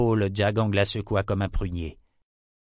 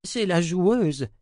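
high, drawn-out woman's voice; high, insistent deferential voice; anxious servant; 9 semitones higher, 4 dB louder, much slower than usual. A narrator's reading. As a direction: add it urgently, pitched high and slow. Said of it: "Elle ajouta".

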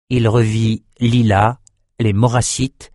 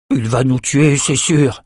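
growled gloomy prediction, slightly raised pitch; 3 semitones higher than usual. A narrator's reading. Brock about the enemy general, growling. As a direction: grumble it with a sense of doom. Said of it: "grogna Brock".

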